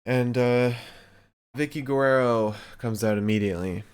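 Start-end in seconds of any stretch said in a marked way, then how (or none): none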